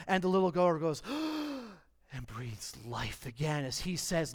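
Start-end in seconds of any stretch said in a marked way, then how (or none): 1.1-1.7 quick high gasp; sharp revival gasp. Acted. Little girl waking from death, sudden gasp. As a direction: gasp back to life.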